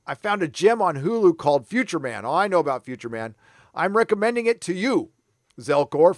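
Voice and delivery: normal talking voice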